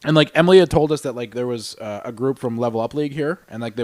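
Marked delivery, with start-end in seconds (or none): none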